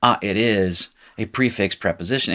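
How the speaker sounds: ordinary talk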